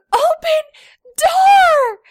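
put-on very high, loud child yell; toddler yell. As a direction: scream it like a small boy. Toddler yelling.

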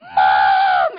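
long, loud imitated scream; retold child's scream. Four-year-old pounding on the back door for mom, screaming. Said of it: "I hear her screaming".

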